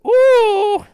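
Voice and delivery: hammed-up cartoon cry; long, high-pitched exclamation; loud high "ooh". Blatchu reacts to the counter, loud high cry. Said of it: "And then Blatchu says".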